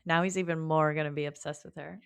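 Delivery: usual speaking voice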